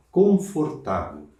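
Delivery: confortável said slowly with each syllable clear; slow model word; slow, drawn out. Shown as a demonstration. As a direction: stretch confortável out for students. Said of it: "Some o o, entra ável".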